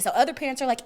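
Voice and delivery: ordinary delivery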